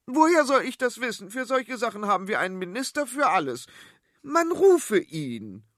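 high, fast and loud, slowing to command; queen ordering the minister summoned, high; haughty queen. Acted. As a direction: toss it off, then order grandly.

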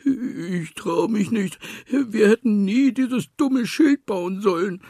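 too scared to open the door; whimpering. A narrator's reading. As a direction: piglet whimpering in fear. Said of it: "wimmerte Schweinchen".

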